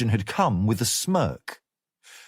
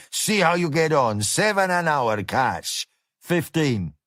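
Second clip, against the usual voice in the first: coarse Mexican-flavoured diner boss, higher; boorish cook boss; 4 semitones higher than usual. Acted. Gomez voiced by narrator.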